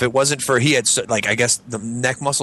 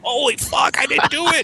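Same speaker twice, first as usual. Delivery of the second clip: high panicked protest; high frantic denial. Retold or imitated. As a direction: blurt it in mock terror.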